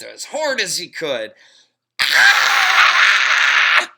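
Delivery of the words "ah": piercing high scream of pain; shrill scream. A narrator's reading. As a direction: scream as the pigtail is yanked. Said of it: "screamed Leslie".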